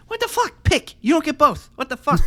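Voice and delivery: high, loud fed-up outburst; exasperated rant. Real, not acted. Ranting to friends about hypocrisy, loud exasperated outburst. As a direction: blow up, pitch way up. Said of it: "ranting at hypocrisy".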